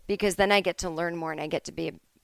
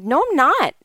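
sharp defensive denial, louder and higher; getting mad; 9 semitones higher, 6 dB louder than usual. Retold or imitated. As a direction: snap back, indignant. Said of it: "I started to get mad".

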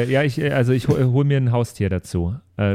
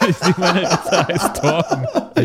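laughing through the words, slower; 4 semitones higher, much slower than usual. Real, not acted. Player laughing as he names his rat. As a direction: giggle out the rat's name.

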